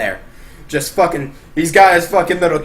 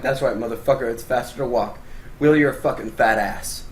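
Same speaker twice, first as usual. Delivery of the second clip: smug taunt; 7 semitones lower, 8 dB quieter than usual. Retold or imitated. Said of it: "And I'm like".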